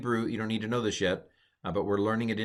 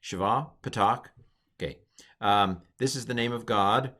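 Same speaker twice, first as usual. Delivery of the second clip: usual speaking voice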